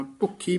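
ਭੁੱਖੀ with a tight doubled kh; short vowel, firm ਖ in ਭੁੱਖੀ; tight consonant. Shown as a demonstration. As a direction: press the ਖ in ਭੁੱਖੀ. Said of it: "ਕੱਸ ਕੇ ਬੋਲਣਾ ਹੈ".

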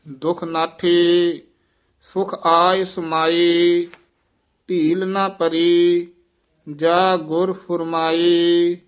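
slow measured chanting of Gurbani; scripture recitation. Acted. Teacher reciting the hymn for students to repeat, measured chant. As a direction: recite each phrase with reverent pauses.

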